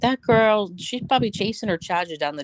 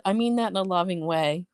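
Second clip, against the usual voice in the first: ordinary delivery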